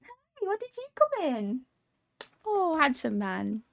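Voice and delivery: high, cooing pet voice. Real, not acted. Greeting the cat in the room.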